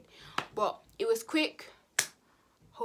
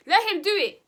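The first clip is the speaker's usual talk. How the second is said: loud high defiant let him; 10 semitones higher, 14 dB louder than usual. Retold or imitated. Telling the ex to go ahead, defiant shout. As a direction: dare him to do it.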